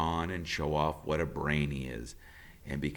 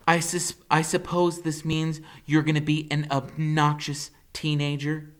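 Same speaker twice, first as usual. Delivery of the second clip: mother's voice; 8 semitones higher, 3 dB louder than usual. A narrator's reading. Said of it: "The Fair Gwen goes".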